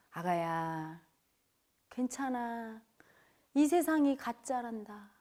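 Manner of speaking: soft, slow, tender reassurance; gentle inner voice. Retold or imitated. Comforting voice heard on the mountain, soft and tender. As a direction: soothe a child with drawn-out endings. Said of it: "이렇게 말하는 것 같았어".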